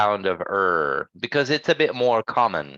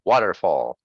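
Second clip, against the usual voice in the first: "Waterfall", weight up front, er light and short; don't push the er in "Waterfall"; quick unstressed er; 5 semitones lower than usual. Shown as a demonstration. Teacher showing no hard vibration, "Waterfall" with quick weak er. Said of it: "it's just quick".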